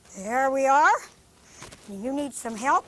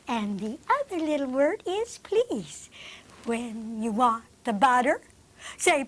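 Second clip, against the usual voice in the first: soft, lower recited verse; poem recitation; 3 semitones lower, 4 dB quieter than usual. Acted. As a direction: recite the poem gently to children.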